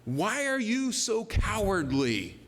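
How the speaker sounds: confronting. Acted. Jesus rebuking disciples in another translation, confronting them. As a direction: confront them, stretching the words out. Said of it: "Jesus gets in their face a bit".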